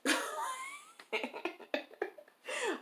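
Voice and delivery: shrieking high laugh; real high-pitched laugh. Real, not acted. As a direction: let out a high squeal of laughter. Laughing at the absurdity.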